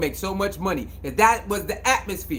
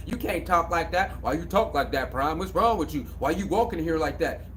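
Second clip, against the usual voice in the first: mocking critics; 6 semitones lower, 6 dB quieter than usual. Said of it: "the media was bombing on Prime".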